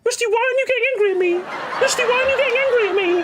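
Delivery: very high, squeaky taunting mimicry; mocking whiny imitation. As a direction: squeal it to make fun of him. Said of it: "That's how you sound".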